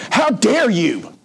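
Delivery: rear up and scold him, offended to the core; indignant outburst. Retold or imitated. Dying woman scolding the young preacher, high outraged voice. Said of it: "She said".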